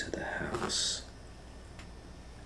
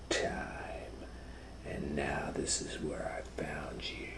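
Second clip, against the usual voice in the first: hushed hunter; 4 dB quieter than usual. Acted. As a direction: creep up on the sleeping wolf. Hunter confronts sleeping wolf.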